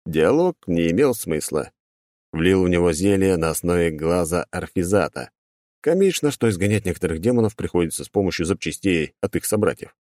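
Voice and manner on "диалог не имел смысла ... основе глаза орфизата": plain reading voice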